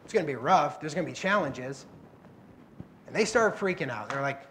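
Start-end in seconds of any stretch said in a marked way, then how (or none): 0.0-1.9 softer, low and unbothered; lightly voiced Jesus shrugging off the storm; a touch of easy reassurance to scared disciples; calm Jesus. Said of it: "He was like".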